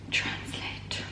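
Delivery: whispered to herself; a private unvoiced aside. Real, not acted. Whispers to herself while searching.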